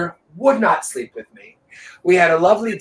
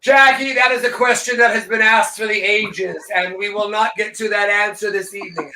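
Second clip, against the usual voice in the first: hammed-up oratory in a raised pitch; mock-grand proclamation; 5 semitones higher than usual. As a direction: proclaim it like a great unanswerable mystery. Mock-grand answer to cohost's question.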